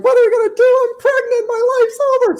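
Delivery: high, loud tearful woman's voice; mock tearful fiance, high and loud; fiance in tears. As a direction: squeal it out like a panicked crying fiance. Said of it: "she's in tears".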